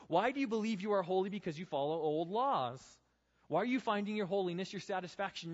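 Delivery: high, loud, urgent rebuke; paraphrasing Paul's rebuke. Retold or imitated. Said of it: "Let me paraphrase some of them for you".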